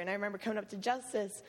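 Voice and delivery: usual speaking voice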